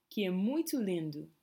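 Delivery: "lindo" ending sounds like "lindu"; final o as u. Shown as a demonstration. Teacher demonstrates "lindo" ending in u for learners. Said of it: "the O at the end has more of an U sound".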